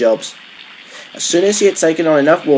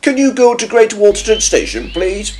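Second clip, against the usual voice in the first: higher, lively request; station master voice; 9 semitones higher than usual. A narrator's reading. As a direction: voice a station master politely asking, pitched up. Station master addresses Stanley.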